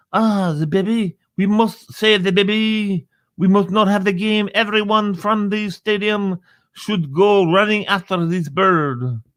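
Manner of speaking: hammed-up French alarm over a baby; French accent. Host mimics French fans urging a rescue, mock French accent. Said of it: "if you had the people from France".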